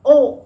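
ओ said long; ओ drawn out; long oa sound. Shown as a demonstration. Teacher giving the jolly phonics ओ. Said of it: "जोलीफोनिक में इसका sound भी यही है".

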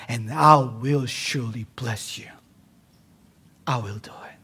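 whispered vow. Acted. Preacher whispering God's tender promise to Abram.

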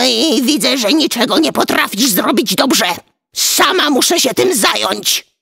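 high-pitched exasperated tirade; wife scolding. A narrator's reading. Witch wife berating her useless husband, high scolding. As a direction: tell him off, pitched high throughout. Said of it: "ta znów zaczęła go łajać".

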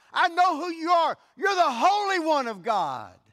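high, loud, anguished demon cry. Acted. Preacher voicing the unclean spirit crying out at Jesus. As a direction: howl like a cornered demon. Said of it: "he cried out".

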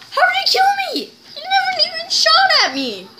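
high, fast indignant yell; raging gamer. Real, not acted. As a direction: rage at an unfair death. Killed unexpectedly in game.

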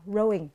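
"rowing" with no W sound; silent W. Shown as a demonstration. Teacher showing the odd word out, "rowing" with silent W. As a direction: leave the W out of "rowing". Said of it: "W ở phương án D là câm".

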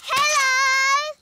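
loud, high, drawn-out hello. Acted. Child greeting a slug who cannot hear well, shouting. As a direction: shout it for someone hard of hearing.

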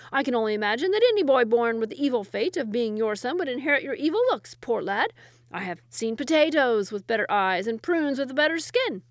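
loud, emphatic insults; scornful retort. A narrator's reading. Second wazir mocking his rival's looks, loud scorn. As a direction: pour on the scorn.

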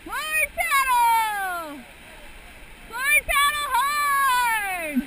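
loud, slow, high paddle call; shouted command. Real, not acted. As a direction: call it out across the river.

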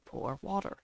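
low, soft parrot imitation; imitating a parrot. Streamer quoting the clever parrot, lightly imitated. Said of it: "and he says".

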